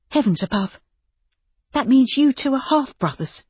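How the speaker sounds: high-pitched exclamation of amazement; astonished woman. A narrator's reading. Woman learns of the half-brothers.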